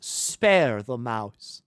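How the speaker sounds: very slow, drawn-out spare; slow deliberate. Acted. Choosing mercy in the game, slowly drawn out. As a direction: stretch every word out.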